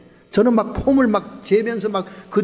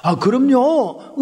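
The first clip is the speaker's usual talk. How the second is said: slow eager reply; 3 semitones higher, much slower than usual. Retold or imitated. Young preacher answering God.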